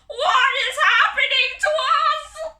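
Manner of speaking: high, loud croaking shriek; panicked croaking monster bird; croaking cry. Acted. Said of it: "croaked the leader".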